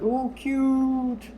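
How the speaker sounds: high, drawn-out mimicked "ooh, cute"; imitated coo. Friend reacting to a cat video, imitated high coo.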